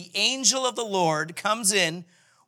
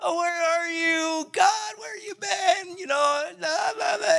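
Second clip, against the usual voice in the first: high, slow wailing whine; whiny false self; 12 semitones higher, slower than usual. Pretend. Gideon in his false self whining at God, high and tearful. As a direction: wail it like a sulky child. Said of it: "he's in his false self".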